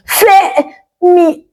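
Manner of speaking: a high, thin call squeezed out in pain; weak pained cry for her husband; thin strained call. A narrator's reading. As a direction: call his name with almost no air. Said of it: "Her voice came out like small air escaping balloon".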